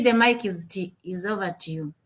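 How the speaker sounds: ordinary talk, nothing marked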